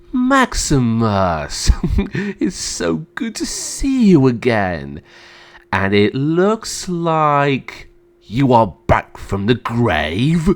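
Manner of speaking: slow, drawn-out gloating with a chuckle; cruel smile. Acted. Emperor greeting his returned brother, cruelly mocking. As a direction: savour each word with a cruel smile. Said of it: "gave a cruel smile and said".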